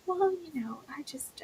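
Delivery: breathy hesitant whisper; timid whispered hedging. Retold or imitated. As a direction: whisper it shyly, holding back. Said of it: "It's like".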